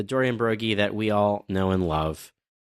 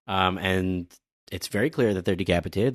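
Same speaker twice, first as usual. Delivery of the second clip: normal talking voice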